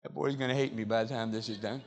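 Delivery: a low throwaway joke under the breath; tossed-off quiet aside; muttered aside. Real, not acted. Aside about running boy.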